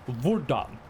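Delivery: hvordan said the Norwegian way, roughly vor-dan. Shown as a demonstration. Teaching chat the Norwegian word for how. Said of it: "you say".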